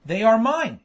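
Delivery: loud, contained narrator's shriek. A narrator's reading. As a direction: cry out loudly to claim what is yours. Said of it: "shrieked Hakkabut".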